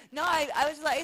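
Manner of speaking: usual speaking voice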